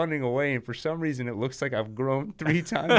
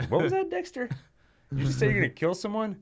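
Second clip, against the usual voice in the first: much higher surprised squeak; high squeaky coworker; 7 semitones higher than usual. Retold or imitated. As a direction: squeak it in alarm. Coworker overhearing Dexter.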